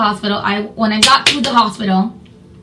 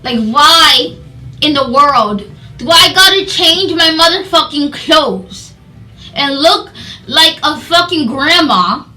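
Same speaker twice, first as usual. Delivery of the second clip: slow, loud, exasperated complaint; frustrated protest; 5 semitones higher, 5 dB louder, slower than usual. Retold or imitated. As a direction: re-enact indignant disbelief, leaning on each word. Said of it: "I'm, I'm frustrated".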